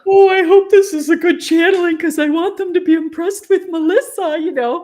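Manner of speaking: high, loud, fretful ego voice; anxious inner voice. Retold or imitated. Channeler voicing her anxious ego wanting to impress, high and fretful. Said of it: "my personality was like".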